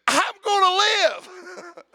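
high, slow, breaking with relief; elated cry. Retold or imitated. Miner reliving escape, high astonished relief. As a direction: cry it out, amazed to be alive. Surviving the mine explosion.

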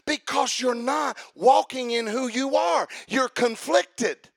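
slow, loud shouted declaration. Acted. Preacher confronting the anxious listener, shouting. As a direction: shout it out slowly.

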